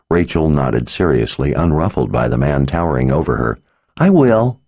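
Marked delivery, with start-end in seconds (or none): 4.0-4.6 put-on high, loud little girl promise; eager little girl. Girl answering her father, high eager promise. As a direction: promise it brightly like a keen child. Child nodding seriously.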